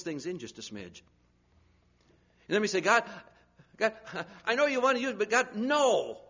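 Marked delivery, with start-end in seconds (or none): 2.8-6.2 high, pleading protest to God; whiny refusal. Retold or imitated. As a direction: beg off like a stubborn child. Congregation voiced refusing God.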